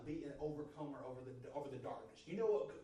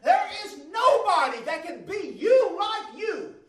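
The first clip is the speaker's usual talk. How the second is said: loud, high, fervent preaching; shouting the sermon up to a climax; full-force preaching; 14 semitones higher, 14 dB louder than usual. Real, not acted. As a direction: preach it out with rising fire.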